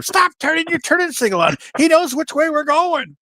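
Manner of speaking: high-pitched retold nagging yell; imitated backseat yelling. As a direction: scold the driver in a high, exasperated imitation. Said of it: "yelling at him".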